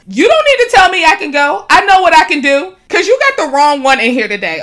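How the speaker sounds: loud and high, fed up; snapping at a man in line, loud and high; angry retold retort. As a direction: put him in his place. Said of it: "I said that except for the".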